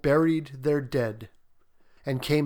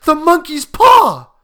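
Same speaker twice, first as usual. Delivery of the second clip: high, loud frantic shriek; wild, high-pitched old wife's cry; 16 dB louder than usual. A narrator's reading. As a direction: shriek it out wildly. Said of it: "she cried wildly".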